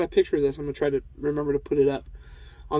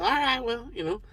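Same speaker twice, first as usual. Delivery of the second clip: higher, lightly done Cotton Hill voice; a hint of Cotton Hill, pitched up; [name] as Cotton Hill; 7 semitones higher, 4 dB quieter than usual. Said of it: "apparently I'm gonna do it".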